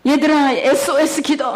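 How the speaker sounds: rally them to pray fast; mother urging her children in the car to pray; urgent call to pray. Retold or imitated. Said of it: "막 그랬어요".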